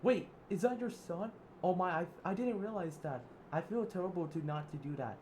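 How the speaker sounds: high, loud flustered gasp of guilt; startled remorse. Acted. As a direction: realize the mistake and panic. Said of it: "Zip Oliver".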